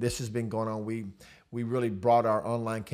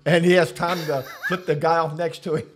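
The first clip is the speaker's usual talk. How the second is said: loud, high-pitched, tickled retelling; amused disbelief; 7 semitones higher, 11 dB louder than usual. Real, not acted. Pastor relishing the wheelie story with his co-host, loud and amused. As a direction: crack up while telling it, voice raised.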